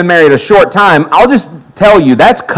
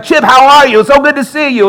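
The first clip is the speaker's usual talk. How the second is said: put-on higher, bright-pitched friendly greeter voice, not a New York accent; cheery greeter; 10 semitones higher than usual. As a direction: greet him like an overjoyed old friend. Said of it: "he's like".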